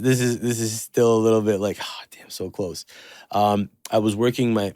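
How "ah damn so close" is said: breathy, soft ah damn so close; muttered regret. Retold or imitated. Recalling a near miss, a soft muttered regret. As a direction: let it slip out under the breath. Sighing to himself over a miss.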